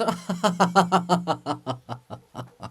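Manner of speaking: hollow group laugh; chorus laugh. Acted. The chorus laughs at the runaway bloke, hollow laugh.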